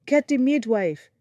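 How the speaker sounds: loud, high urgent shout. Acted. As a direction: shout for the midwife. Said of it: "Obi shouted".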